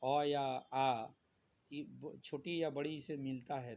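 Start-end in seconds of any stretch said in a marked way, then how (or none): 0.0-1.1 अ या आ said slowly and louder, each vowel held out; a teacher drawing out the vowels; drawn-out vowel demo. Shown as a demonstration. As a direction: stretch out अ and आ for the class. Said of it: "ए का निर्माण अगर करना होगा".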